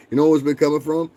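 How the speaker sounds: usual speaking voice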